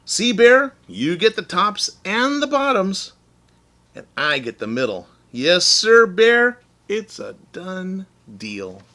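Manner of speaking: high, loud and triumphant, slowing at the end; gleeful Hare. Acted. Hare showing Bear his share, gleefully. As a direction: Hare crowing over his clever trick. Hare gloating over the deal.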